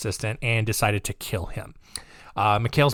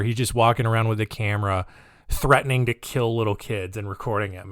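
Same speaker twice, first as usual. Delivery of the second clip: normal talking voice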